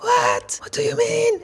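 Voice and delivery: high, loud startled husband; husband hearing his wife's water broke, high and loud in shock; shocked disbelief. Retold or imitated. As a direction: jolt up in shock and demand an answer.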